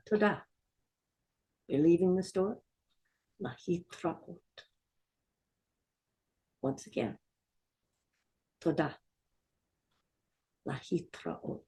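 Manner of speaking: quiet practice of Toda and להתra'ot; gentle call-and-response demo; softly modelling toda and lehitraot. Shown as a demonstration. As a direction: model the words softly for learners to repeat. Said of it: "Let's say it with me".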